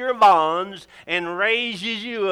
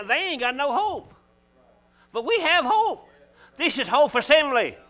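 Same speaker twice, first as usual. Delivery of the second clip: climbing higher and louder to a shout of hope; rising preach; 7 semitones higher, 4 dB louder than usual. Real, not acted. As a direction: build it up into a triumphant proclamation.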